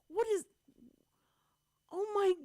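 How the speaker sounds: high, loud gasp of disbelief; retold horror, pitch leaping up, breaking off mid-sentence; shocked exclamation. Retells coworker's shock at porn on air.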